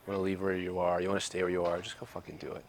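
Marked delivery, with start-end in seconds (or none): 1.8-2.6 whispered. Real, not acted. Intense urging at end of pep talk.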